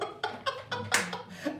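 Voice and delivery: high airy unvoiced laugh; high breathless giggle. Real, not acted. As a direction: squeal of laughter in a whisper. Recalling the funny hotel moment.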